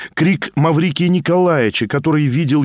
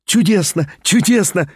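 hysterical laugh; 4 semitones higher than usual. A narrator's reading. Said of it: "истерически рассмеялась Лиза".

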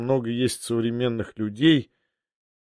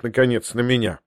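usual speaking voice

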